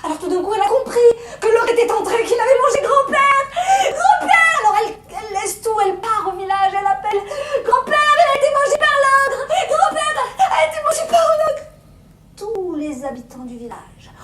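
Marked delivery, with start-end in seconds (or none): 0.0-11.6 very high, loud crying out; high shrieking panic; panicked alarm. Acted. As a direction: scream for help in terror. Girl panics and calls village.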